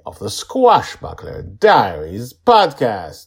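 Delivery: loud, high, slow show opener; announcer intro. Acted. Host opening his podcast, loud high announcer voice.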